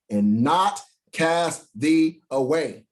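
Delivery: slow, weighty "and not cast thee away"; emphasised slowly. Shown as a demonstration. Preacher stressing the verse to the congregation. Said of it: "listen to this part".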